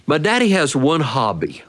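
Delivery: ordinary delivery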